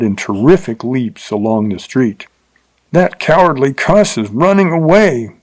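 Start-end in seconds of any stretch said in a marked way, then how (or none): 2.9-5.3 higher, jeering shout at a fleeing rider; derisive yell. A narrator's reading. Bill jeers at the man riding off, derisive yell. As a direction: yell it with contempt. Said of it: "yelled Bill derisively".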